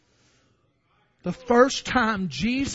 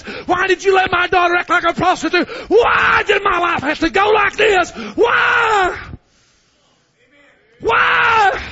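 high, loud shouted why to God; anguished questioning; 6 semitones higher, 7 dB louder than usual. Acted. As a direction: shout it at heaven in grief. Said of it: "That sounds just like you".

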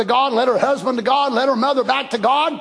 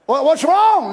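exasperated; 5 semitones higher, 4 dB louder, slower than usual. Retold or imitated. Exasperated spouse asks again.

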